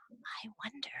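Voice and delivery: very soft, wondering whisper. Pretend. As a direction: whisper it to yourself. Hushed guessing game.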